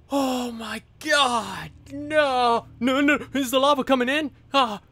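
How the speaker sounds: high, loud panicked cries as lava closes in; panicked shout. Real, not acted. Gamer panicking as lava floods toward him, frightened shouting. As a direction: yell in real alarm.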